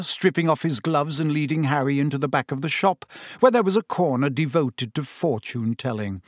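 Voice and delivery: ordinary delivery, nothing marked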